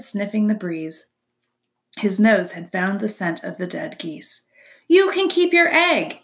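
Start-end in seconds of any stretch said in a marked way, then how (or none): 4.9-6.2 high, loud, offhand fox; dismissive fox. A narrator's reading. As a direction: shrug it off like a sly fox. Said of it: "he said as he trotted".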